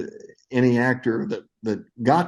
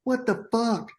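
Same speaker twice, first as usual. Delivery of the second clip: much higher-pitched, imitated indignant director; mock exasperated outburst; 12 semitones higher than usual. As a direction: play the director in disbelief, high-pitched. Director berates actor, retold.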